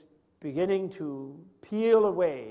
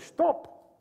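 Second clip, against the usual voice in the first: a high, strange-sounding "Stop."; 11 semitones higher than usual. Shown as a demonstration. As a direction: read "Stop." off the sign in a much higher voice. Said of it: "it still says".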